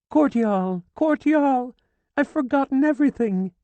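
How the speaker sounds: high, loud crying out; anguished wail. A narrator's reading. Widow crying to dead Cortial, anguished. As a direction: cry his name in despair. Widow clutching husband's body.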